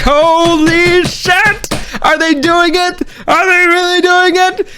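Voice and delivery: very high, stretched fanboy squeal; ecstatic disbelief. Retold or imitated. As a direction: pitch it way up in thrilled amazement. Replays climax of fan disbelief.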